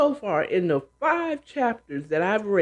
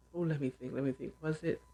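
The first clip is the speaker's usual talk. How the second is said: much softer, thinking aloud under the breath; muttering to self; 4 semitones lower, 14 dB quieter, faster than usual. Real, not acted. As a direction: murmur while searching your memory. Trying to recall a show.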